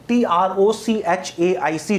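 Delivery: slow letter-by-letter spelling; unhurried classroom spelling. Shown as a demonstration. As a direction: spell each letter evenly for students to follow. Teacher spells the term out.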